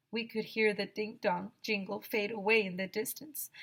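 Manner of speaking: ordinary delivery, nothing marked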